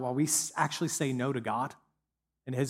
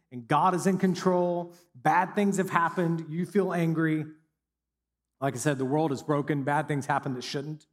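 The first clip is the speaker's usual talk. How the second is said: usual speaking voice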